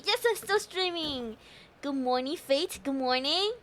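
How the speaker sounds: ordinary talk, nothing marked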